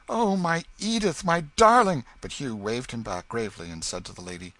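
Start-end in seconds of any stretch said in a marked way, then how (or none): 0.1-2.0 high, loud lover's cry. A narrator's reading. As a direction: fling it out with longing.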